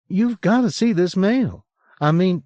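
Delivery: high, bright-toned Southern drawl, lightly voiced; a hint of a Southern twang, upbeat; a woman announcing bear news in Southern drawl. A narrator's reading. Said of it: "her southern drawl".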